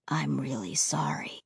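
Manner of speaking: low, quiet heavy voice; sorrowful ghost boy, low and soft. Acted.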